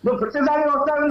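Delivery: high, heated accusation; worked up. Real, not acted. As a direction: scold him for interrupting. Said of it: "ఓ రెచ్చిపోతావేంటి".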